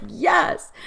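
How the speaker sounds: high, emphatic yes; retold relieved yes. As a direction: act out being finally understood. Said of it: "she was like".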